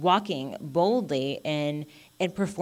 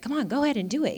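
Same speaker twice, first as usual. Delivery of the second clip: higher-pitched eager nudge; encouraging; 7 semitones higher, much faster than usual. Acted. Holy Spirit nudging us to act, encouraging. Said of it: "encourages us".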